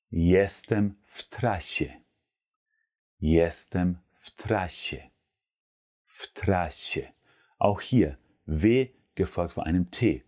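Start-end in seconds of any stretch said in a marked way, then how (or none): 0.1-7.1 jestem w trasie slowed down; slow demo. Shown as a demonstration. Teacher slowly models jestem w trasie. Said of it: "dann sage ich auf Polnisch".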